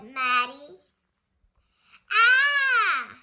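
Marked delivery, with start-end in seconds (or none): 2.1-3.2 much higher, louder yelp of alarm; alarmed cry. Acted. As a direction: cry out in sudden fright.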